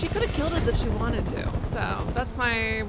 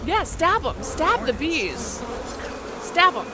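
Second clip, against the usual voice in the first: cheering on; 4 semitones higher, 6 dB louder, slower than usual. Real, not acted. Urging character to attack bees.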